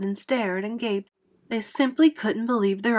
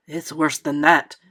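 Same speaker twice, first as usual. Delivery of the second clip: lower-pitched, lightly voiced police chief; dire cry; 5 semitones lower, 4 dB louder than usual. A narrator's reading. Police chief corrects the fire chief, crying out grimly. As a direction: cry out a grave warning, pitched low. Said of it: "cried the chief of police".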